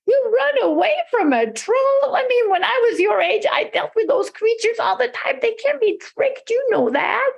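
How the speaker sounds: high-pitched, incredulous scoff; scoffing father. A narrator's reading.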